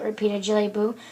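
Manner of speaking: ordinary delivery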